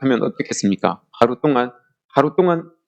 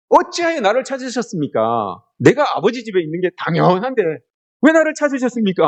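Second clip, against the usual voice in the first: much higher, incredulous questioning; cheeky young Jesus; 7 semitones higher than usual. Acted. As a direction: protest as if it were obvious. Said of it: "하신다는 말씀이 더 가관입니다".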